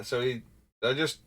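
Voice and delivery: ordinary talk, nothing marked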